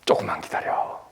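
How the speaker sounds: whispered 조금만 기다려 as a tender promise; soft whisper. Acted. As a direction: lean in and breathe hope. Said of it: "희망을 불어넣어준다 이거예요".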